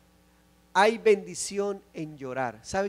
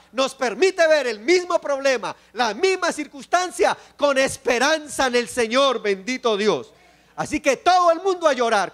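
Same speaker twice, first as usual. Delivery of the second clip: loud, fervent pulpit preaching, pitched higher; sermon climax, voice raised and ringing; full-force preaching; 5 semitones higher, 7 dB louder than usual. Acted. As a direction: preach it to the whole congregation.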